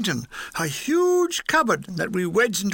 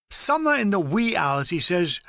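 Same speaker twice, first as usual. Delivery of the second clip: ordinary delivery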